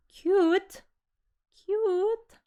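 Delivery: high, puzzled repeat of the word; surprised question. Real, not acted. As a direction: echo it back in disbelief. Confused at chat's remark.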